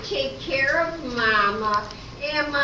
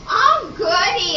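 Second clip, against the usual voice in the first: higher and louder, a giddy squeal; delighted as if the money's already hers; thrilled squeal; 7 semitones higher, 5 dB louder than usual. Acted. Trixie thrilled by prize money.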